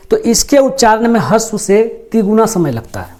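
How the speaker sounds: ordinary delivery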